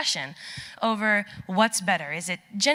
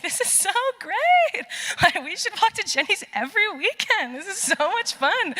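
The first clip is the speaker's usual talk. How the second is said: forced cheer; 11 semitones higher than usual. Retold or imitated. Hiker keeping a brave face for new friends, forced cheer. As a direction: gush to cover up the misery. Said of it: "I'm keeping the bravest face".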